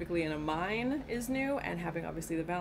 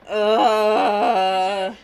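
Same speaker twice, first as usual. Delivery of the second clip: disgusted groan; 15 dB louder than usual. Real, not acted. Disgust at boiled body.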